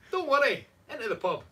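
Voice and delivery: high, loud Irish reassurance; rally a mate in put-on Irish; mock Irish accent. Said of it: "say it with an Irish accent".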